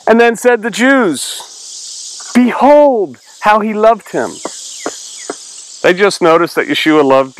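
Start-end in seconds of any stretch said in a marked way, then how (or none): 0.1-4.3 higher, slow declaimed verse; drawn-out, raised-pitch recitation; scripture reading. Acted. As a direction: proclaim it from the Bible. Reads scripture aloud.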